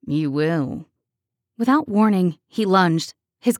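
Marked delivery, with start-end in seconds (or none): 0.1-0.9 low menacing echo. A narrator's reading. Killer repeats, softer and lower.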